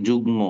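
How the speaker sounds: যুগ্ম said jugmo, the m sounded; যুগ্ম with g then m, no doubling; spoken m demo. Shown as a demonstration. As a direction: say যুগ্ম as jugmo, not juggo. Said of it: "এটা উচ্চারণ হবে যুগ্ম".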